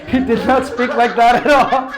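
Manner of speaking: loud, high, through laughter; laughing. Real, not acted. Speaker admitting his trainer never sounded so, laughing. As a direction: crack up while admitting it.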